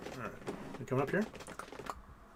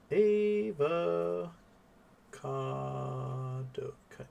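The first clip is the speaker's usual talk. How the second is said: stretched-out slow avocado read aloud; drawn-out name reading; 4 dB quieter, much slower than usual. Shown as a demonstration. Reading a donor name aloud, stretched long and slow. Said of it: "I can't spell cado".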